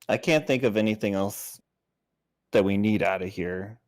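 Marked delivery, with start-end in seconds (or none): none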